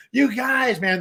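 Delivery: imitated exasperated plea; high, mock-exasperated appeal; breaking up fight. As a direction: pull two squabbling friends apart. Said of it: "pulling them apart going".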